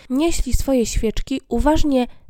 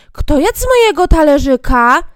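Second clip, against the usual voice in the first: loud little dwarf, a bit higher; 4 semitones higher, 10 dB louder than usual. Acted. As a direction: call out like a small indignant dwarf. Second dwarf asks. (głośny głos krasnoludka)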